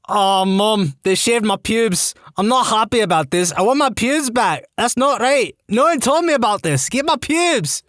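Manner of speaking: high-pitched, indignant Scottish wail; broad Scottish accent. A narrator's reading. Outraged patient complaining to mother at visiting hours, broad Scottish accent. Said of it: "I'm shouting in my broad Scottish accent".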